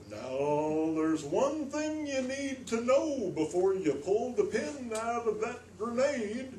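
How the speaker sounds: slow suspense demo; slow dramatic delivery. Shown as a demonstration. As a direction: drag it out like a thriller narrator. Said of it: "Or you can slow it down".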